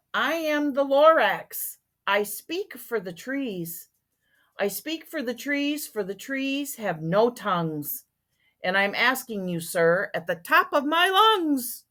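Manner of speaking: a touch sharpish and bossy; lightly voiced bossy creature, rising to emphatic finish; Lorax. A narrator's reading. Lorax speaking up for the trees, mildly sharpish and bossy. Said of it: "he spoke with a voice that was sharpish and bossy".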